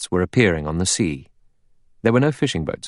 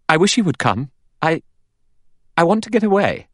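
high, louder, urgent plea to get away; anxious outburst; 11 semitones higher, 4 dB louder than usual. A narrator's reading. Vera to her companion, wishing the boat would come, anxiously. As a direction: voice climbing with rising panic.

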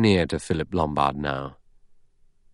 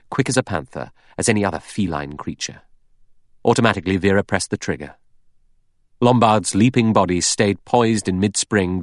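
ordinary delivery, nothing marked

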